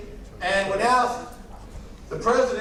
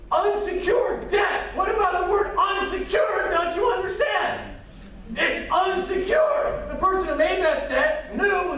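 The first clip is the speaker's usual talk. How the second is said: angry shouting; 9 semitones higher than usual. Real, not acted. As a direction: shout it in outrage. Ranting about unsecured government debt.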